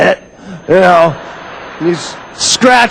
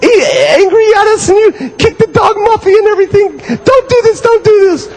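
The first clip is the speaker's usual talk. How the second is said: much higher, crying mock children; hammed-up tearful pleading; whining kids; 12 semitones higher than usual. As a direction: beg mommy in a sobbing little kid voice. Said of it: "And they go".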